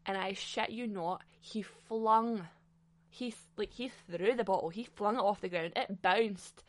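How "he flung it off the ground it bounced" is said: ordinary talk, nothing marked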